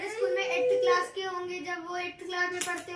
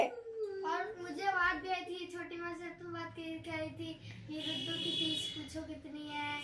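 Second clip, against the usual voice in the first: soft teary confession; quiet, teary; 10 dB quieter than usual. Real, not acted. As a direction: murmur it while still teary. Son confides after crying.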